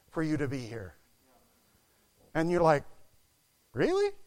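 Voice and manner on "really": high, rising mock-doubtful question; sceptical surprise. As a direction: squeak it upward like a doubting listener. Voicing a doubtful listener's reaction.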